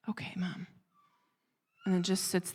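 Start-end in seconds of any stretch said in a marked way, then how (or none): 0.1-0.7 put-on small, hushed child giving up; defeated. Scolded daughter answers her mother, quietly defeated. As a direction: imitate a crushed kid, very soft and breathy. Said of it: "she sounds so defeated".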